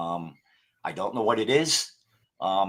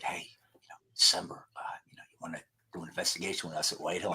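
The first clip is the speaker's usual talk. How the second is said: retold whisper, fast and hushed; quick hushed whisper pulling a friend aside; whispered invitation; 4 semitones lower, 11 dB quieter, much faster than usual. Partner taking him aside to ask.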